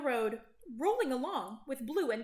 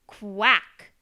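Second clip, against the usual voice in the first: low, very loud quack; duck quack; 4 semitones lower, 12 dB louder than usual. A narrator's reading. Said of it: "says the duck".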